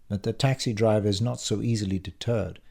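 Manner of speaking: plain reading voice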